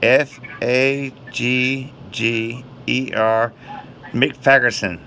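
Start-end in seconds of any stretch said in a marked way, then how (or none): 0.0-3.5 letters spelled out very slowly; slow spelling. Real, not acted. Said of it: "how do you spell that".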